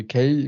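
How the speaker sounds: name said with an ay vowel; Kay, rhyming with day; English-style Kay. Shown as a demonstration. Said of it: "speak English call me".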